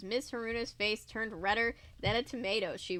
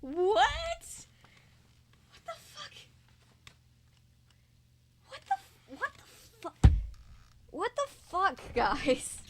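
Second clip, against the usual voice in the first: high, incredulous what the fuck; can't believe what he's reading; stunned disbelief; 11 semitones higher, 3 dB louder, slower than usual. Real, not acted. Reader reacts to the absurd fanfic in shock.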